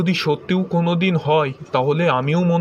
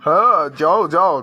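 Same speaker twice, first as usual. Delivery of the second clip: dismissive; 3 semitones higher, 5 dB louder than usual. Acted. Husband dismisses angry wife.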